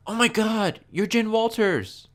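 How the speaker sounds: hammed-up high starstruck gasp; mock starstruck. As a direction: squeal it like a starstruck fan. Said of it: "Warren's like".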